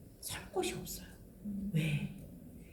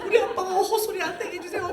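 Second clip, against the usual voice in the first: high mock child's prayer; begging child; 5 semitones higher, 4 dB louder than usual. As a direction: beg fervently like a little kid. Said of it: "애들이 막 빌어, 빌어".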